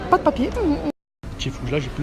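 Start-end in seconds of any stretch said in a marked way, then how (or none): 0.1-0.5 squeaky disbelief; shocked high squeak. Retold or imitated. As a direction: blurt it out in shock. Imite l'agent, très aigu. (stupéfaction aiguë)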